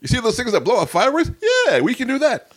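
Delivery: high, hyped-up mock recruiter; eager sales pitch. Guest acting the recruiter pitching mortars, excited high voice. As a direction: sell it with high-pitched enthusiasm.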